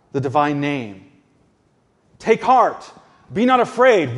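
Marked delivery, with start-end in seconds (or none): 2.2-4.2 higher and louder, proclaiming "Take heart, be not afraid"; forceful preaching. Acted. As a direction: declare it to the congregation with full force. Preacher repeats Jesus' words forcefully.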